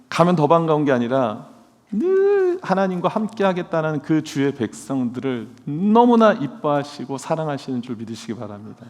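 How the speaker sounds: ordinary talk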